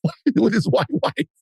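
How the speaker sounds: mock outraged why. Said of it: "I'd be like".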